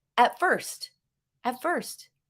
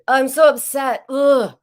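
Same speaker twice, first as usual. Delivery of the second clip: higher and much louder, fed-up groan; mock upset groan; 5 semitones higher, 9 dB louder than usual. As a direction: blurt out the frustration, then groan. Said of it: "if you go to food".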